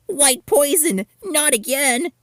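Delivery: hammed-up panicked wail; horrified exclamation. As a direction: recoil from the milk in mock horror. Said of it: "she exclaimed".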